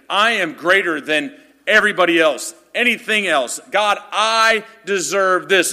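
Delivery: proud boast. Pretend. Prideful heart claiming it deserves better, loud and smug. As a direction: puff yourself up, slow on the last line. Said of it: "we begin to say".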